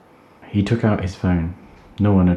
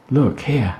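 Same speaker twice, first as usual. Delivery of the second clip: excited cry; 7 semitones higher than usual. Acted. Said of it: "Holly cried".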